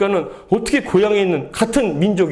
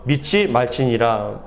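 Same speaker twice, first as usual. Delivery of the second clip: slow, lower verse ending; congregational scripture reading; 7 semitones lower, much slower than usual. A narrator's reading. Pastor ends the verse read with the congregation, slow solemn reading. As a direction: close the verse slowly and low.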